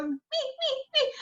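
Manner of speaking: little one. Acted. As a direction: playfully squeak like the littlest child. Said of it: "I'm the little one".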